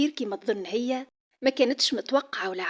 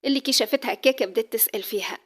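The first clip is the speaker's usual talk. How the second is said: ordinary talk, nothing marked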